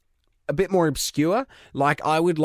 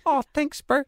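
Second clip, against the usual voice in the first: much higher cartoon whale; 12 semitones higher than usual. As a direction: thank them like the beached whale. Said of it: "I end up sounding like the whale".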